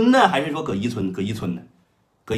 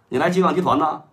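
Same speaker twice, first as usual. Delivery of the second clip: ordinary delivery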